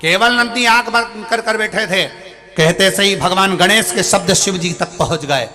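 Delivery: high, loud sermon at full force; preacher rousing listeners against mockers; fervent preaching. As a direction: drive the point home to the crowd.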